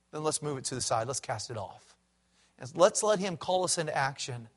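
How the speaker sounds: low, soft, tender correction; gentle voice of God. Retold or imitated. God gently correcting his people who claim they know, soft and low. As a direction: quietly call them to act, kindly. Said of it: "He says".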